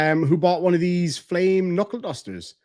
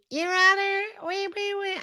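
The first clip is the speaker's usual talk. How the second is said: very high, soft mock whimper; hammed-up pleading through pretend tears; fake crocodile tears; 4 dB quieter than usual. Streamer imitating woman begging the judge, fake tearful. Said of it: "trying to do crocodile tears".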